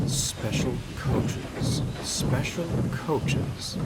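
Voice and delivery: slow whispered musing; thinking aloud whisper. Acted. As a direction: whisper it to yourself, slowly. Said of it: "he thought".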